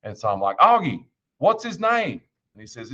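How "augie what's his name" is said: put-on high, slow, fed-up question to the kid; exasperated call. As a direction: drag it out, at the end of your rope. Driver calls to kid on bus.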